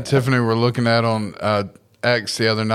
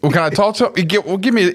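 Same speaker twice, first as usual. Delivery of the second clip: faked friend voice; 7 semitones higher, much faster than usual. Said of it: "imitate his best friend's voice".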